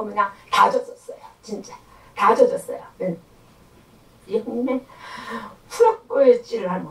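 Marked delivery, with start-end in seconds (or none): none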